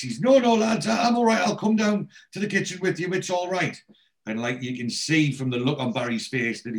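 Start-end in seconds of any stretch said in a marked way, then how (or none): 0.2-3.8 high-pitched mock panic; panicking. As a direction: hastily reassure the lads in an imitated, high panicky voice. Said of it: "And Barry panics".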